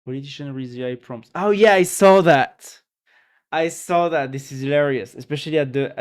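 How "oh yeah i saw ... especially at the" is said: amused excitement. Real, not acted. Reacting to a politician's AI speech video, loud and delighted. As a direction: burst out, thrilled to recognise the clip.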